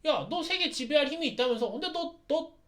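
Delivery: high, needling teasing voice; mocking taunt. Knight Gundam taunting the demon lord, mocking high taunt. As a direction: poke at him to provoke. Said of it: "도발을 하는 거예요".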